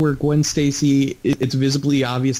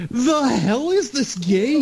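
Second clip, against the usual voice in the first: high, drawn-out disbelief; incredulous reaction; 9 semitones higher, slower than usual. Retold or imitated. Player reacting to a crude game joke, incredulous. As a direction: stretch it out high, baffled by the game. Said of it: "I'm just like".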